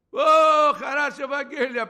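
very loud, high, drawn-out exclamation; jubilant outcry. Retold or imitated. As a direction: shout the good news for joy. Retold leap of joy at promise.

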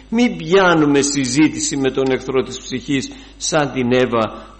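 loud preaching call; exhortation. Acted. Preacher urges the listeners tonight, loud. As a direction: urge them on at full voice.

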